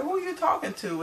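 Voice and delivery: high-pitched mock indignant question; retold indignant challenge.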